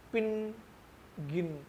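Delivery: पिन and गिन said short, vowel not drawn out; teacher contrasting short इ with long ई; short vowel demo. Shown as a demonstration. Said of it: "ये मैंने खींचकर नहीं बोला".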